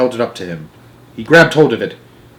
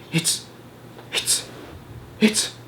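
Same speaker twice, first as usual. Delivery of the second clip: much softer breathless stammer; awed hush; 5 semitones higher, 11 dB quieter, much slower than usual. A narrator's reading. Giant stammers in awe over the dream in the jar, hushed. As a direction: barely get the words out in wonder.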